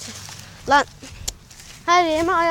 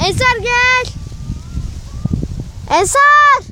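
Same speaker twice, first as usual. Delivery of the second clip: calling out; 7 semitones higher, slower than usual. Real, not acted. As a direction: call his name across the yard. Calling friend.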